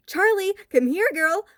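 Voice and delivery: high, loud command to the dog; demanding call. A narrator's reading. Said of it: "Lewis demanded".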